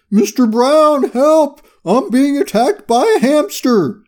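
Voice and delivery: high, loud panicked scream; screaming for help. A narrator's reading. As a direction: scream into the phone in comic panic. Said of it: "Fred screamed into his phone".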